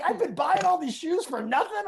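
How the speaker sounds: high-pitched incredulous imitation; mock disbelief. Retelling the duped friend learning the truth, mock incredulous.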